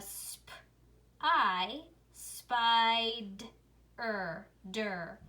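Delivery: "sp, I, spied, er, der" piece by piece; separate, drawn-out sounds in "sp, I, spied, er, der"; sounding out. Shown as a demonstration. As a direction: blend "sp" and long "I" into "spied", then "er, der". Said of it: "if I put that all together".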